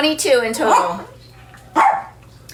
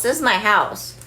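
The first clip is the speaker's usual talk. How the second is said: normal talking voice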